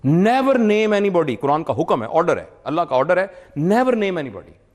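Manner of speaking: usual speaking voice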